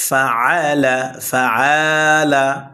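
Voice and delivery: ফাআলা stretched very slowly; overly long ফাআলা; wrong drawn-out reading. Shown as a demonstration. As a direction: demo the mistake: drag out ফাআলা. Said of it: "এভাবে টেনে বলা যাবে না".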